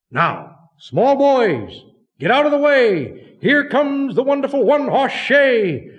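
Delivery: loud, high call to clear the road; a crowd yelling as the shay rolls past. Acted. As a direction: shout it down the street at the small boys. Boys calling out at passing shay.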